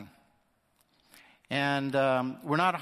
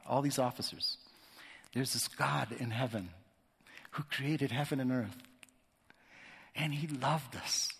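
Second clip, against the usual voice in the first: heartfelt gospel to the officers; tender witness; 6 dB quieter than usual. Real, not acted. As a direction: gently offer it as good news.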